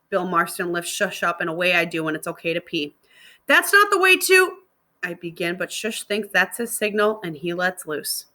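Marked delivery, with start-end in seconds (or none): 3.5-4.6 high, loud, cut-off cry; raised alarmed call; alarmed protest. Acted. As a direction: blurt it out in a panic. Girl cries out in protest.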